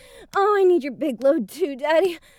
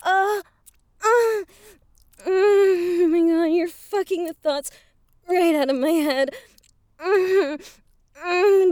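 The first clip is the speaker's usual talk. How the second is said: moaning; 2 semitones higher, 4 dB louder than usual. Acted. Moaning during sex roleplay.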